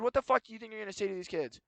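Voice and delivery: mild mock yell, quick and clipped; retold yell. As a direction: rattle it off like telling someone off. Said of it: "I, like, yelled at him".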